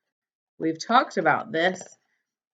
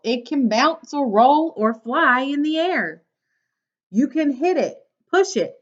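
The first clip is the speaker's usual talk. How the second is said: bright, lively storybook read; reading to children; 4 semitones higher than usual. Acted. Teacher reading a picture book to her class, bright and lively.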